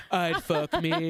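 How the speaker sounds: slow, low sassy drag echo. Retold or imitated. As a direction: repeat it like a drag queen, laughing. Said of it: "Did you hear that drag voice?".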